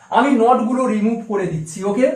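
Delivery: normal talking voice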